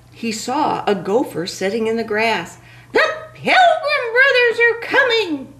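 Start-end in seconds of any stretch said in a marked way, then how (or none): 2.9-5.5 high panicked turkey warning; frightened cry. Acted. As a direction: cry out in alarm. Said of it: "the turkey cried".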